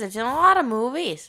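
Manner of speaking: loud, high Michigan accent. Real, not acted. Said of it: "first time I've heard your Michigan accent".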